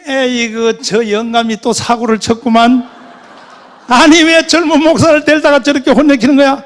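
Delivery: loud, high nagging outburst; acted indignant wife's yelling; scolding wife. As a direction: tell off your husband at full volume. Wife scolds her husband.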